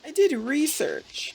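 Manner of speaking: high, slow mocking parrot; mocking imitation. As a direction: repeat it back high and slow. Mocks the character's line back.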